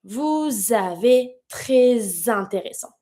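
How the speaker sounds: Vous avez très intéressant said slowly, each s linked as z; slow liaison demo. Shown as a demonstration. Teacher slowly modelling liaisons for learners. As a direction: link every s to the next vowel as a z, slowly. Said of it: "And you're gonna pronounce it".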